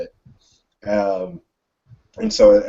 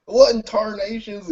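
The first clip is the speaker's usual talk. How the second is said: Southern cop shout; 13 semitones higher than usual. Acted. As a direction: shout it like a stunned country cop. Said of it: "And he's, like, shouting".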